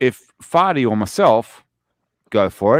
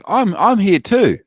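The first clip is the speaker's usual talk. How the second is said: mock celebrity; 8 semitones higher, 4 dB louder than usual. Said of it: "it's like".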